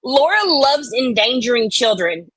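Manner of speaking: ordinary talk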